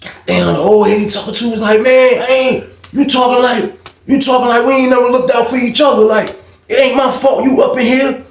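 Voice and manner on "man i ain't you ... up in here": high-pitched, aggrieved retold protest; arguing back. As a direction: act out the old head pleading his case heatedly.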